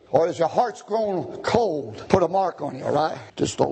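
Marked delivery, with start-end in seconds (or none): none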